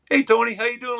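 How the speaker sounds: spring the prank with a big friendly hello; mock cheery greeting.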